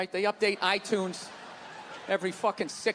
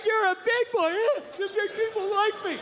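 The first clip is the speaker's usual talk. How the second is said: much higher, patronizing grown-up cooing; mock praise to child; 11 semitones higher than usual. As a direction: coo it down at a little kid.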